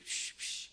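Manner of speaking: unvoiced hushing shh; shushing. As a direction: hush someone sharply. Shushing God in prayer.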